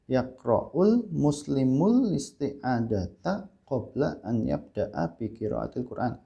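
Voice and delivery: slow, syllable-by-syllable Arabic in an Indonesian accent; plodding Indonesian accent on Arabic. Shown as a demonstration. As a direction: show the wrong way: Arabic with Indonesian intonation. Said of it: "logatnya logat Indonesia".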